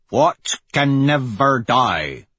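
put-on slow, drawn-out growl in an Obsidian accent. A narrator's reading. Severo challenging the contact at the container doors, growled Obsidian accent. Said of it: "growls in his best Obsidian accent".

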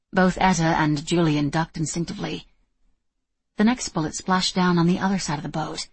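ordinary delivery

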